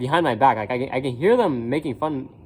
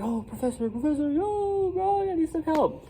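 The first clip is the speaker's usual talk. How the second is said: pleading call-out; 5 dB quieter than usual. Retold or imitated. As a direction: call the professor in an exaggerated high voice. Acts calling professor for help.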